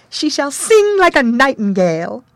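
high, loud sing-song fairy. A narrator's reading. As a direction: lift it up like a sprite. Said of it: "The fourth said".

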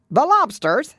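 high, loud interrupting cry; shouting. A narrator's reading. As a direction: burst in with a shout. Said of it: "shouted the Gryphon".

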